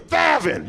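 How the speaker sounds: "Thevin" called out with a lisped th; high-pitched lisping mom saying "Thevin"; lisped name. Acted. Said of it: "Your mom has a lisp".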